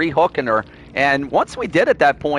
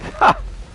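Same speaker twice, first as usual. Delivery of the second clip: high laugh. Real, not acted. Laughs after outburst.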